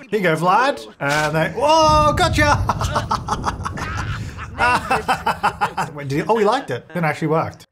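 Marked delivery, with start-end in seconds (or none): 1.5-2.5 high, loud surprise shout; spring it on them with a big high yelp; whooping prank reveal; playful gotcha. Pretend. Springs a funny gift on Vlad. 2.6-5.9 high, drawn-out laughter after a gotcha; big playful cackle; long prank laugh. Pretend.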